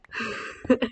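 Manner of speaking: short real chuckle; self-conscious laugh. Real, not acted. Streamer realizing nobody may have seen the stream, self-conscious laugh. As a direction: chuckle at your own predicament.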